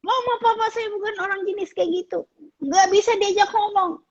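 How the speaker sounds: high, mock whining teenager; whiny teen. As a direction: whine like a fed-up teen. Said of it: "Kalau kamu bilang". (remaja merengek, nada tinggi)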